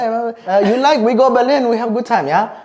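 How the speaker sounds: high, loud mock German accent; hammed-up German buddy invite in a German accent. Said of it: "he's German".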